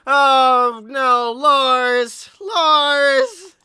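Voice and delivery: loud, pained Oh no, Lars; shocked dismay. Real, not acted. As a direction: groan his name in disbelief.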